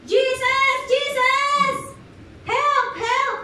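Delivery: high, loud cries for help; acted blind beggar yelling out; desperate shouting. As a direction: shout over a crowd to get his attention. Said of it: "He started shouting".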